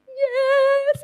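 high, long, jubilant cry; elated yes. Retold or imitated. Woman voices her mother finally agreeing to come forward, elated. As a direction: burst out with a triumphant yes.

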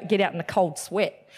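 usual speaking voice

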